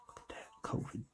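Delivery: breathless, very soft whisper of awe. Real, not acted. Vlogger spots the covid in the box and whispers in awe. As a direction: hush it as if the thing could hear you. Sudden peek at the package contents.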